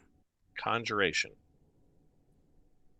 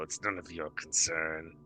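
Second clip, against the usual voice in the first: slow, low, softer repeat; quiet creature voice; 6 semitones lower, 6 dB quieter, slower than usual. Acted. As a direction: murmur it in Primordial, lower. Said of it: "It says in Primordial".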